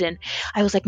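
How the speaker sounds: ordinary talk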